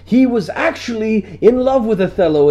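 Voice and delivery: mock literary debater; pompous young scholar. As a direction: affect a learned tone. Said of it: "I'd be like".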